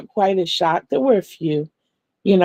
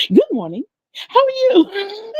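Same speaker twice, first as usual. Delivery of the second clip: sing-song greeting; 13 semitones higher than usual. Retold or imitated. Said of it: "I was like".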